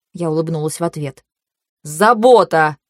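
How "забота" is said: irritated, slightly louder retort; angered echo. A narrator's reading. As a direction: throw the word back crossly. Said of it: "Он явно рассердился". (сердито, с нажимом)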